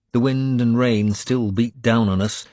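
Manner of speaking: plain reading voice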